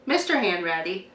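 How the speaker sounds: ordinary delivery, nothing marked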